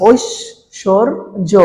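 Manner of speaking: ঐশ্বর্য very slowly as "oish-shor-jo"; slow teaching demo of ঐশ্বর্য, "oish-shor-jo"; syllable-by-syllable pronunciation. Shown as a demonstration. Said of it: "এটার উচ্চারণটা কি হয়ে গেল".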